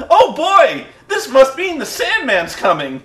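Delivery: put-on gleeful eight-year-old boy, high and loud; Mike Tenay. Host mocks an announcer spotting a wrestler, childishly thrilled high voice. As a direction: squeal it like a kid hearing the ice cream truck. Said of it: "in the same voice an eight-year-old boy would say hearing an ice cream truck".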